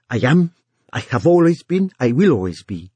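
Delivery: ringing with conviction. A narrator's reading. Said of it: "voice rang with conviction".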